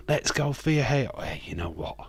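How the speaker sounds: normal talking voice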